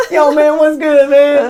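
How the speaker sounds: high, loud, hammed-up bro voice; mocking, overdone street greeting; put-on slang greeting. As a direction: imitate a guy suddenly switching his talk to fit in. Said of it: "why did you just convert like that".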